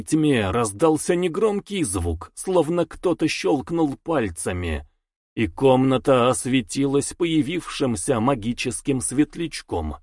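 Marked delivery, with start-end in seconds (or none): none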